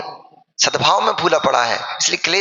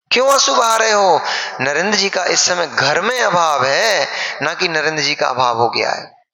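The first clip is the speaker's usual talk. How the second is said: ordinary talk, nothing marked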